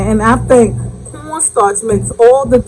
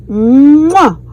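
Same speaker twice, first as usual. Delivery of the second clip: loud kiss sound; 4 semitones higher, 8 dB louder than usual. Real, not acted. Kiss after signature phrase.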